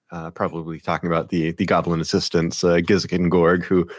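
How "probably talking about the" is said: ordinary talk, nothing marked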